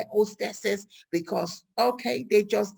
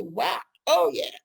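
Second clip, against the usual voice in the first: sarcastic road rage; 7 semitones higher, 5 dB louder than usual. Retold or imitated. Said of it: "you say".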